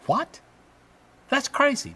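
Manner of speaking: high incredulous what, voiced for a doubter; mock disbelief. Preacher plays a doubting listener, high mock disbelief. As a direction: squeak it out like a scoffer who can't believe it.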